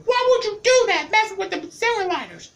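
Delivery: high falsetto imitation of an angry mom; upset mama. Mama scolding her kid over the lighter, high falsetto mock scold. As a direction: squeak it out like a scolding mother. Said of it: "she got upset with me".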